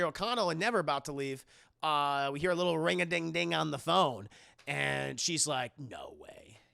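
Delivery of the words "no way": very soft, low, slow mock disbelief; hushed disbelief. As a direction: drop to a stunned undertone. Retold disbelief on hearing phone.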